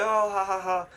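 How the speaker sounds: high, silly mock laughing; spelled-out pretend laughter; imitated group laughter. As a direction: fake a giddy laugh dissolving into la-las. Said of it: "we all started laughing".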